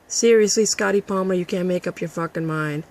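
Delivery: ordinary delivery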